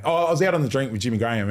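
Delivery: ordinary talk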